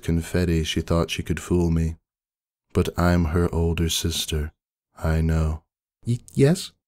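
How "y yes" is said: high, small, hesitant; timid stammer. A narrator's reading. Pinkie answers Maud, caught out, high and stammering. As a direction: catch on the first sound, frightened. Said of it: "she asks".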